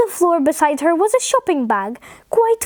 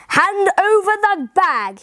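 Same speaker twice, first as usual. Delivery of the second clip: slow, raised-pitch angry demand; 4 semitones higher, slower than usual. A narrator's reading. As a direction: demand it like a cross storybook man, word by word. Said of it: "he said angrily".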